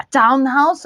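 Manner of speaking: ordinary talk, nothing marked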